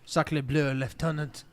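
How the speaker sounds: slow, hammed-up French accent; a jokey French officer's French accent; mock French accent. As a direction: player hams up his French character's French accent. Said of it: "That's exactly how it sounds".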